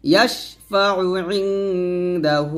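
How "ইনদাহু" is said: ইনদাহু with the final hu drawn out; long vowel. Shown as a demonstration. Teacher shows students to lengthen hu in ইনদাহু. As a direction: stretch the hu of ইনদাহু slowly. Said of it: "হুতে তিনে টান দিবেন".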